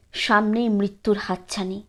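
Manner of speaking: ordinary delivery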